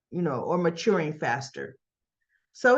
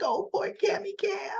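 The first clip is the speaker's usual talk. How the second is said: very high cooing sympathy; mock baby-talk commiseration; babying pity; 12 semitones higher, 4 dB quieter than usual. Recapper pitying crying Cam, cooing.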